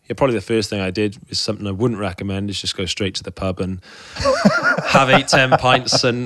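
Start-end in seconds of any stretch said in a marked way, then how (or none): 4.1-4.8 a short burst of real laughter, rising. Real, not acted. Laughing about heading straight to the pub. 4.8-6.3 slow, words broken by real laughter; laughing. Real, not acted. Laughing to the host about downing pints.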